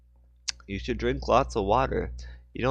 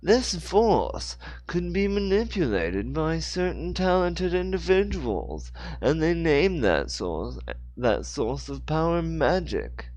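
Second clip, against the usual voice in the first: higher, slow, grand British storyteller voice; British voiced Joseph; 8 semitones higher, slower than usual. Joseph Dixon tells Hannah the legend, slow and grand. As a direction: intone the legend slowly. Character line in read story.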